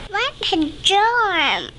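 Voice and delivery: high, slow chirpy bird voice; twittering wren. Acted. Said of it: "twitters wren".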